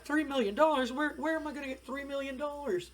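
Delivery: high-pitched panicked disbelief; squealed, hammed-up panic; mock freak-out. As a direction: act out a wrestler freaking out, voice shooting way up. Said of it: "he's freaking out".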